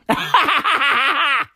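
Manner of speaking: a high burst of laughter; big real laugh with a falling end. Real, not acted. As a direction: crack up at your own joke. Laughing at his own joke.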